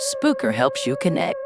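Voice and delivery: normal talking voice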